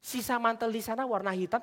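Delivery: ordinary talk, nothing marked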